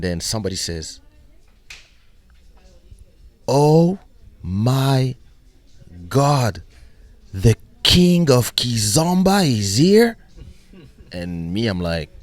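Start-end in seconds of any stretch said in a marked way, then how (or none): 3.5-10.2 high, loud, drawn-out amazement; imitated excited fan outburst; acted starstruck shout. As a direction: exclaim slowly as if spotting a star. Acting stranger who recognizes him.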